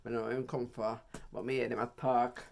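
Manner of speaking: usual speaking voice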